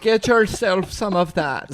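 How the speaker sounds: high mock German accent; lightly hammed German accent. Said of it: "starts talking like a German".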